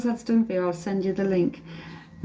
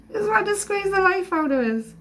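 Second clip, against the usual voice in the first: high-pitched, laughing through the words; 10 semitones higher than usual. Real, not acted. Breaks into laughter while describing the hug.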